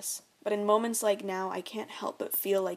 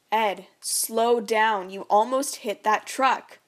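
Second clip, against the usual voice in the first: louder, slow urgent plea; pleading; 6 dB louder, slower than usual. Acted. Mom begs the drunk dad to slow down, urgent. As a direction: beg him to slow down.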